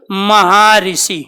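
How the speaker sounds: Maharshi stretched out syllable by syllable; slow pronunciation. Shown as a demonstration. Teacher sounds out Maharshi slowly for students. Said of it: "अब इसका उच्चारण कर लो एक बार".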